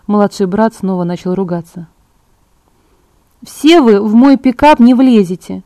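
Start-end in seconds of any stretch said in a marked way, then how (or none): none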